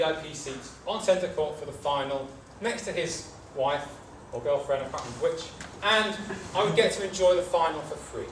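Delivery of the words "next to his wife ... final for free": usual speaking voice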